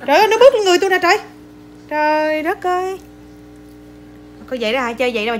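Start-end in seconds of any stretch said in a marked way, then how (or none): 0.1-1.2 high, loud startled yelps; startled exclaiming. Real, not acted. Crying out as it steps on her, startled yelps. 1.9-3.0 slow, high, drawn-out astonishment; astonished exclamation. Real, not acted. Reacting to being stepped on, drawn-out astonishment.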